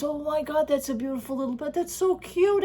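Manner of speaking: high, quick gushing over a cute bag. Retold or imitated. Wife gushing to husband over a cute bag, excited and high. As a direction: squeal it like you've just spotted the perfect bag.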